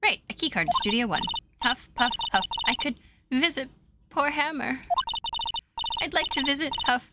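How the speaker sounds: lightly voiced, breathless old woman grumbling; a hint of a cranky old lady; winded old lady. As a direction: huff through the threats like a tired grandma. Old lady character lines.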